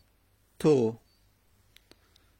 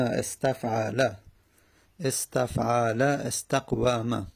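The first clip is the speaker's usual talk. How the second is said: slow spelling-out; 2 semitones lower, slower than usual. Shown as a demonstration. Teacher spells out word pattern.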